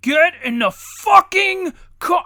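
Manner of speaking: slow, high, full-volume furious dad shout; loud cursing yell. Acted. Dad cursing at his kids before the neighbors, loud angry yell. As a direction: bellow it at the kids, leaning on the curse. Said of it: "I curse at 'em loudly in front of my neighbors".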